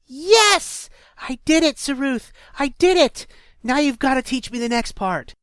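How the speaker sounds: high, loud victory yell; triumphant shout. Acted. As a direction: shout it out in elation.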